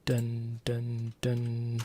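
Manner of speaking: ordinary delivery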